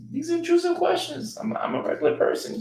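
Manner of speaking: high falsetto retelling of a protest; squeaky, put-on objection; mock protest. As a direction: plead your case in a comic high voice. Retells fending off date's money questions.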